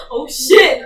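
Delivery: loud startled shout; alarmed shout. Retold or imitated. Kid realizing she hit her sister, loud startled shout. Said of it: "And I was like".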